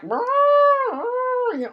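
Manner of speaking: very high, loud squeal; all over the place. Retold or imitated. Mimicking women reuniting, very high loud squeal. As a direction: swoop the pitch way up. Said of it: "they're like, all over the place".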